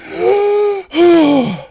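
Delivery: long, high gasping inhale; big recovering gasp; deep gasp for breath. Real, not acted. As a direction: suck in air after laughing breathless. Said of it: "my first deep breath".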